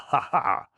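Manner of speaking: high, loud uproarious cackle; villain's laugh. Acted. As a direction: throw your head back and cackle. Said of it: "laughed uproariously".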